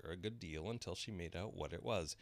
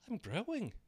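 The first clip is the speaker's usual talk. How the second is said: Alice voice; 5 dB louder than usual. A narrator's reading.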